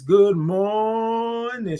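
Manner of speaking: "morning" held very long, high; drawn-out good morning. Real, not acted. Host greeting all the viewers, "morning" drawn way out. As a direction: stretch "mor" into a long high note, then land "ning". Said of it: "all those O's and R's and I N G's".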